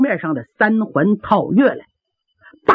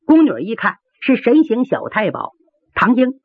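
ordinary delivery, nothing marked

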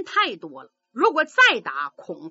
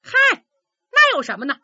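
loud, higher cocky dare; defiant challenge; 5 semitones higher, 6 dB louder, faster than usual. Acted. Boy demanding the credit, cocky loud dare. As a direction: throw down the challenge.

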